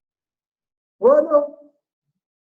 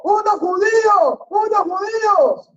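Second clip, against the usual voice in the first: high, drawn-out shout of discovery; alarmed passenger cry; 10 semitones higher than usual. Retold or imitated. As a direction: cry out like a startled passenger pointing at them.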